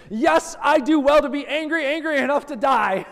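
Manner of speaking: high, loud defiant shout; tantrum shout. Retold or imitated. Jonah shouting back at God in his tantrum, high and loud. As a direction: yell it back like a furious child. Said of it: "goes all out in his temper tantrum".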